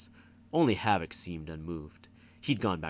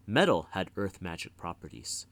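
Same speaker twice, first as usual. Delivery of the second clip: ordinary delivery, nothing marked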